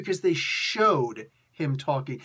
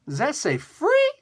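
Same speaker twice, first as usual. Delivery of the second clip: high, drawn-out amazed question; exaggerated delighted disbelief; mock surprise; 11 semitones higher, 6 dB louder, slower than usual. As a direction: shoot up in pitch on "free". Acts surprised at a free comic.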